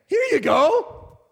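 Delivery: slow, high, beaming God handing over a gift; joyful God voice. Acted. Pastor voicing the Lord giving gladly, slow and high. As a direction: give it like a delighted father. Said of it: "The Lord gets joy out of saying".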